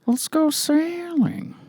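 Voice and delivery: very slow, high, soft put-on voice; hammed-up, whispery celebrity impression; Owen Wilson. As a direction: stretch each word out in a wispy high drawl. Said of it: "you sound more like, like the Joker".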